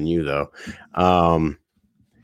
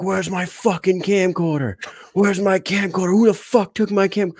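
imitating a furious drunk yelling, much higher; raging Joel; 10 semitones higher than usual. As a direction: play a lunatic demanding his camcorder. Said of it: "loses his shit on the whole party".

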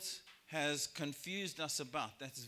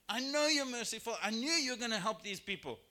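Jonah complaining; 6 semitones higher, 7 dB louder than usual. Retold or imitated. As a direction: play Jonah griping that he knew God was merciful. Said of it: "And so Jonah was like".

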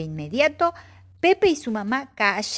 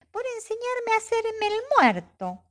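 put-on much higher small child voice; little Pepe voice; 13 semitones higher than usual. Young Pepe thanks the bear in a high little voice.

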